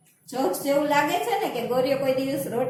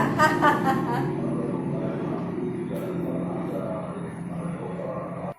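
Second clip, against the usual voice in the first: breathy laugh. Shown as a demonstration. Laughing demo after a joke.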